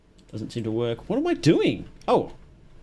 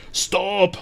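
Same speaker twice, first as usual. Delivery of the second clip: higher, mock German scream; heavy German accent; 6 semitones higher than usual. Imitating the mad operating-room medic, heavy German accent. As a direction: imitate a German yelling in protest. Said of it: "in a heavy German accent".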